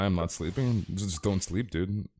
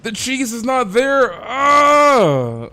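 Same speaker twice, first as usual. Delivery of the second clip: shrieking, slow, much higher and louder panicked shopper; mock freakout; 13 semitones higher, 11 dB louder, much slower than usual. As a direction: ham up a man losing it over missing cheese. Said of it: "He's like".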